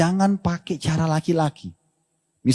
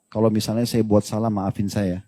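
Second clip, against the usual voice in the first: soft, lower gentle wife apologising; soft apology; 6 semitones lower, 5 dB quieter than usual. Retold or imitated. Wife softly asks her husband for forgiveness, gentle and tender. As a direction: plead sweetly for forgiveness, gently.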